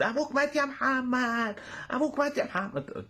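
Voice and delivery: high, drawn-out imitation lament; keen like a hammed-up mourner; mock anguished wail. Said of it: "يا لهوي اللي بحرقة دي".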